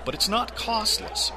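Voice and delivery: friendly promo voice for the podcast; ad read. Acted. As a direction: pitch the books and DVDs to listeners.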